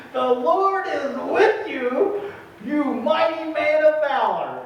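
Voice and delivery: high, slow, ringing announcement; the angel hails Gideon, lifted and slow; grand angel proclamation. Acted. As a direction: proclaim it like a heavenly herald.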